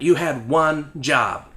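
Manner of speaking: very slow, each word spaced; slow deadpan. Pretend. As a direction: drag it out scolding. Deadpan scolding jab.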